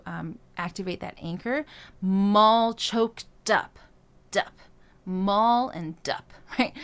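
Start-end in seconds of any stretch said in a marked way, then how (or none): 2.0-6.3 "Mall" and "dup" with the M and D pushed hard, slow; consonant emphasis. Shown as a demonstration. Coach drilling consonant anchors slowly. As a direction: drag the consonants onto the words. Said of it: "use some of the consonants".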